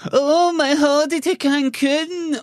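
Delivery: very high, drawn-out whine about a holiday; exaggerated high-pitched lament, held long; mock wailing complaint. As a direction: wail it slowly like a hammed-up moaner. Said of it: "see the complaint of going".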